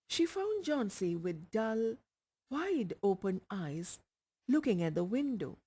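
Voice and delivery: usual speaking voice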